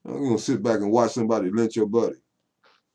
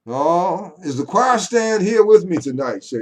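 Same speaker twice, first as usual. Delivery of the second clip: loud, high church call; fired-up preaching; 8 semitones higher, 9 dB louder than usual. Pretend. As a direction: demand an answer from the congregation. Preaches, calls for amens.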